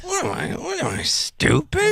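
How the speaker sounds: high mocking voice repeating the question; mock echo; mocking squeaky echo. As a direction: parrot it back in a silly high voice. Mocking repeat of partner's line.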